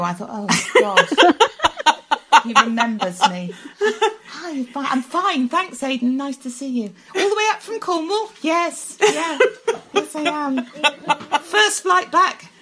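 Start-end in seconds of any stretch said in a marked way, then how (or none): 0.3-3.5 flustered laugh. Real, not acted. Starstruck, recognised by him. 3.8-12.5 flustered. Retold or imitated. Flustered chat with star.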